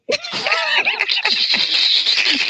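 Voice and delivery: sudden high burst of laughter; big laugh. Real, not acted. Laughs at his own coach joke.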